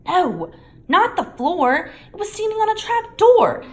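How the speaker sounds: high, loud, impatient; exasperated Hermione. A narrator's reading. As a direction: explain it, fed up. Hermione explaining.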